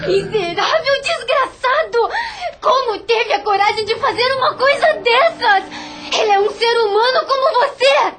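high, crying outburst of rage; furious tirade. Acted. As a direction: tear into him, sobbing with fury. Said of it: "enfurecida, atirou-se sobre ele, esbravejando".

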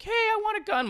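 very high-pitched mock tiny man; squeaky little-man demand; little short guy. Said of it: "you're five foot tall and you're like".

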